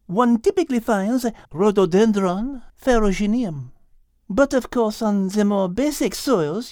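put-on high, French-accented gentle guide voice; a cheerful French cow warning her friends; high French-accented voice, caution about avalanches; French cow Liz. Liz lectures on plants.

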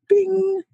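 high-pitched "bing" chime; imitated ding. Co-host mimicking a copyright alert, high imitated ding. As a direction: sing out a tiny alert bell.

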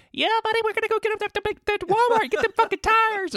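high-pitched, loud hillbilly screech; screechy hillbilly. Retold or imitated. Co-host imitating the new water board member, screechy hillbilly accent. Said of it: "he's, like, weirdly, like, screechy".

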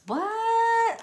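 very high, drawn-out "What"; astonished. Real, not acted. Reader turns up The Lovers card, high astonished question. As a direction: squeal it in amazed disbelief.

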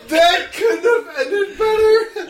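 cracking up, high-pitched; words squeezed out through laughter; laughing. Real, not acted. Laughing hard at the joke.

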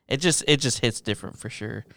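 usual speaking voice

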